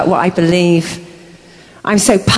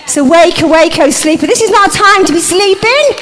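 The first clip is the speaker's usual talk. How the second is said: fiery preaching; 5 semitones higher than usual. Acted. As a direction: stir the crowd to its feet. Preaches at full force.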